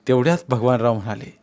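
ordinary delivery

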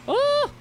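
high, loud delighted ooh; excited ooh. Real, not acted. Streamer thrilled by the mower game, high ooh.

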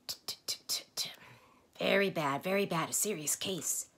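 a whispered tutting, then loud raspy diagnosis; tut, then croak the verdict; a raspy doctor giving a grim diagnosis. Acted. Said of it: "strange croaking way".

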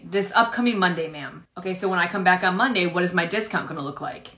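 ordinary talk, nothing marked

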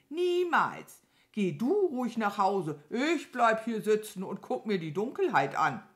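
hohe, laute, bockige Krähe; trotzige Krähe. Acted. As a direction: trotzig auftrumpfen. (bockige Krähenstimme, laut)

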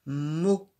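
"Mo." said loud and rounded; teacher giving the answer "Mo." loudly; answer syllable. Shown as a demonstration. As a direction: give the answer "Mo." clearly. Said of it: "Quelle est cette syllabe?".

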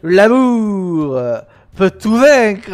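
loud, slow, drawn-out declaration; hammed-up, high and loud; grand proclamation. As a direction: proclaim it slowly like a grand motto. Reads a game line grandly.

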